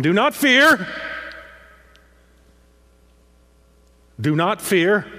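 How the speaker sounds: loud, high cry of reassurance; proclaimed loudly. Acted. Preacher voicing King David calling out to Mephibosheth. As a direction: call it out over the whole room. David's words in scripture reading.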